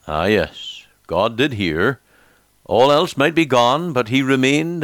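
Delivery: ordinary delivery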